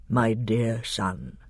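deep, slow, tender lion voice; Aslan. A narrator's reading. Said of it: "the deep voice said".

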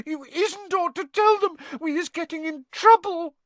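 very high squeaky elf plea; a frightened elf begging him to stop; pleading elf. A narrator's reading. Winky begs her master not to tell them.

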